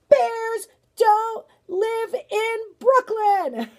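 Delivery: loud, high, slow and emphatic; defiant declaration. Acted. Boss grandmother facing down the bear, loud and defiant. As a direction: lay down the law word by word.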